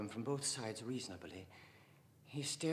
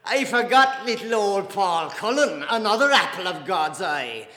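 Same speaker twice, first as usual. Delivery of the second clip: coarse scorn; 8 semitones higher, 16 dB louder than usual. A narrator's reading. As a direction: crow sarcastically at full voice. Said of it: "guffaw of coarse scorn".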